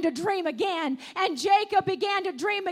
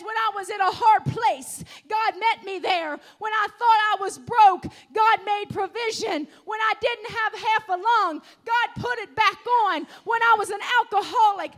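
louder, punching each line of testimony; full-force testimony preaching; 4 dB louder than usual. Acted. Preacher voicing grandparents to the church, shouting.

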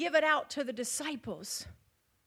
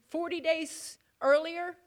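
ordinary talk

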